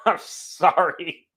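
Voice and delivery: slow, soft apology through laughter; laughing apology. Real, not acted. Host apologizing to listeners for ranting, laughing. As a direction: apologize while laughing, drawing it out.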